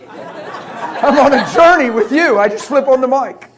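loud laughing delivery. Real, not acted. Excited laughing preaching aside.

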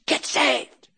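high, forced burst; strained, high-pitched demand; insistent mock retort. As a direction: imitate a zealot insisting, pitch jumping up. Man's insistent reply, acted out.

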